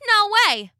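high cheeky refusal; cocky little cookie voice; gingerbread man. A narrator's reading. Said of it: "said the gingerbread man".

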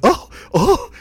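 high, loud mock gasps; hammed-up shock; the vapors. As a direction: gasp like a scandalised fainting dandy. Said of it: "he got the vapors immediately".

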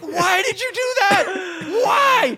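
high, loud, laughing disbelief; stunned and incredulous; aghast why. Retold or imitated. Teller asking Marie in disbelief, high and loud. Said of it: "I was like".

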